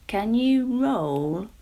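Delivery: very slow, loud rumbling question; a stony creature weighing her up; rock voice. Acted. The rock asks Jemima, slow and loud. Rock's voice.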